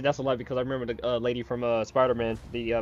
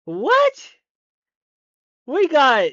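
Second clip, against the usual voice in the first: loud, high-pitched cries of disbelief; real astonishment, voice shooting way up; stunned shock; 11 semitones higher, 11 dB louder, much slower than usual. Real, not acted. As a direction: burst out, floored by what you see. Stunned by reveal in trailer.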